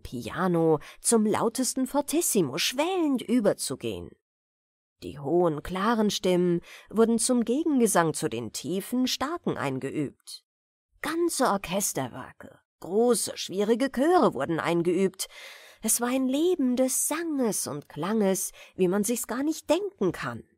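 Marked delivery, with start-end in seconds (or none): none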